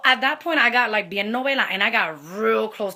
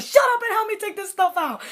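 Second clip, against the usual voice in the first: high, loud, angry order; telling the friend to shut up and help, furious; mad snapping; 11 semitones higher, 4 dB louder than usual. Retold or imitated. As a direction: bark it at your friend in a rage. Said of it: "I'm really mad".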